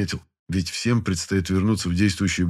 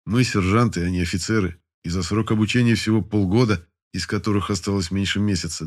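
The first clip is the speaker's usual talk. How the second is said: ordinary delivery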